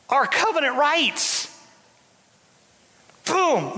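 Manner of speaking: high, urgent preaching; rally the church like a revival; fiery preaching. Real, not acted. Preacher telling the church it was deceived, fiery preaching.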